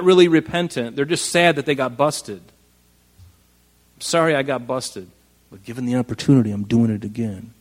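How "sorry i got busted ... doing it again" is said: defiant non-apology. Retold or imitated. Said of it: "that's the attitude".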